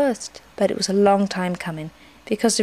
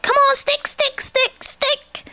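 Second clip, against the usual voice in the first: very high, excited cheering; calling out; slower than usual. A narrator's reading. Roo urges the stick along, calling out excitedly. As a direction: urge it on in a high squeal. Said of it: "calling out".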